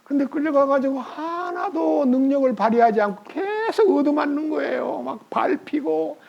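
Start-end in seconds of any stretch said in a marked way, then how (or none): none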